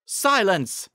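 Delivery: loud, high royal cry; commanding shout. Acted. Celestia silencing Zecora with a loud high shout. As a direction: cut her off with a sharp command. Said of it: "Celestia exclaimed".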